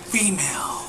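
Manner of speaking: lightly voiced dramatic trailer narration; a hint of an epic movie narrator; epic fantasy narrator. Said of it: "I could do a better job".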